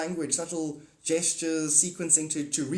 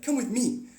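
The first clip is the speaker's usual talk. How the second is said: lofty God voice; 8 semitones higher than usual. Retold or imitated. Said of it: "God's like".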